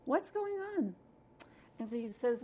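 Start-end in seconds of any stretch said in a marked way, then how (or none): 0.1-0.9 high-pitched acted bafflement; baffled. Husband to crying guest every night, baffled. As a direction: pitch the puzzled question way up.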